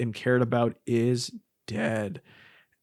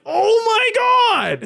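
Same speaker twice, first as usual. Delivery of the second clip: mock horrified gasp; 5 dB louder than usual. Viewer reacting to the ornaments trashed, mock horror. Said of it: "And you're like".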